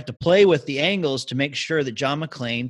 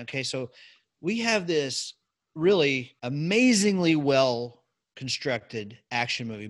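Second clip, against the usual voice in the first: ordinary delivery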